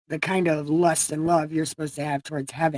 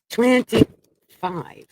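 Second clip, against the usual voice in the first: twenty-five stretched slowly and pitched higher; drawn-out announcement; 5 semitones higher, 5 dB louder, much slower than usual. Real, not acted. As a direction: drag out the chapter number for effect. Announcing the chapter number.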